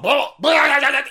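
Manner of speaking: high, loud rattling babble; imitated sawzall rattle. Showing the saw on the car, loud rattling noise. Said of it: "and it's all rattling".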